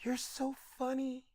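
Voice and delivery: high, very soft, breathy-sounding quote. Retold or imitated. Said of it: "looks me dead in my face and goes".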